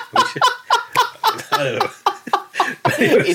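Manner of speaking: high laughing, words squeezed out. Real, not acted. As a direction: crack up while trying to talk. Laughing at his Wrexham gap year.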